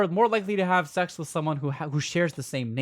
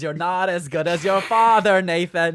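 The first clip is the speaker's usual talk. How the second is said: put-on raised, emphatic movie-villain delivery in a Danish accent; Mads Mikkelsen accent; 2 semitones higher, 5 dB louder than usual. Proving he remembers the Abduction line, in a Mads Mikkelsen accent. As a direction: imitate the film line in a Mikkelsen-style accent. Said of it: "got this Mads Mikkelsen accent".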